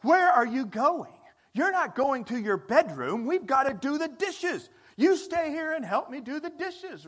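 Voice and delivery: high, loud mock demand from a sibling; bossy sibling. Sibling demands help with the dishes, high and loud. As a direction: play the bossy sibling ordering the other to stay. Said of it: "one sibling to another was".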